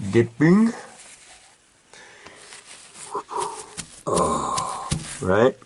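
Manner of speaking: effortful grunts while working; strained grunting and groaning. Real, not acted. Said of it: "Excuse the grunting and groaning".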